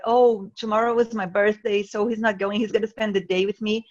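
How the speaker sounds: ordinary talk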